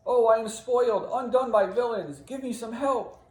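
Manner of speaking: high, loud wounded cry; desperate plea. Acted. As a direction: wail to a would-be rescuer. Wounded Cassio begs Iago for help.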